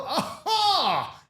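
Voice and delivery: high, drawn-out "ha" of ridicule; mocking laugh. Laughing at the man who admitted his threat, high mocking cackle. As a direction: burst into a big high mock laugh.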